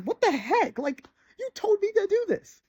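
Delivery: high, incredulous protest at the unfair dad; indignant disbelief. Retold or imitated. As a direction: squeak it out like a wronged kid in disbelief. Kid protests at drunk dad.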